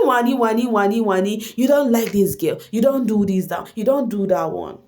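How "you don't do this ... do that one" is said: normal talking voice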